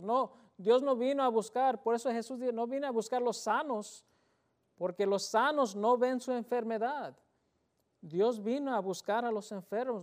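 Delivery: fervent sermon delivery; impassioned preaching. Real, not acted. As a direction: drive the point home to the congregation. Preaching at full force.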